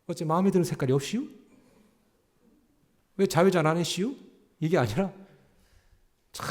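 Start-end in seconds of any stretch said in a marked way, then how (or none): 0.1-4.1 quick Chungcheong dialect with a slight lift; playing a laid-back Chungcheong traffic cop; Chungcheong accent. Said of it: "충청도 경찰관이". (충청도 사투리로 빠르게)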